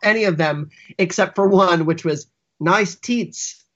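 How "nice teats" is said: Australian accent. Shown as a demonstration. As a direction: deliver it in a light Australian accent. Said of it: "like, in an Australian accent".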